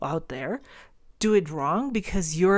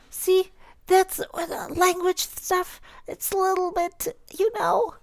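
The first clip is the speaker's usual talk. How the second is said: squeaky high voice; 13 semitones higher than usual. Acted. Joking about language stuff.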